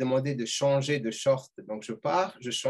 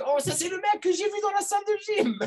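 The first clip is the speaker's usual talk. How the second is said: mock astonished shout, much higher; surprised shout. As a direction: shout it out, amazed to recognise him.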